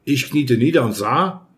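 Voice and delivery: ordinary delivery, nothing marked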